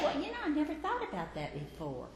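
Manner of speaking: quick, light, laughing Eve voice; Eve musing. Acted. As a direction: brush it off with a little laugh. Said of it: "Eve is going to be saying to herself".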